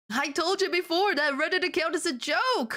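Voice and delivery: high pathetic whine. Acted. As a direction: whine it pitifully. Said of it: "Voldemort whined pathetically".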